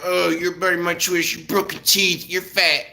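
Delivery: put-on high, childish teasing voice; mock schoolyard taunt. Mimicking grown men taunting like a kid, high and childish. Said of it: "I'm going around talking about".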